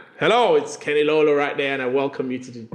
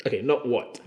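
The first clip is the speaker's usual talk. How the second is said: ordinary talk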